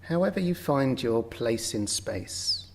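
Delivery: ordinary talk, nothing marked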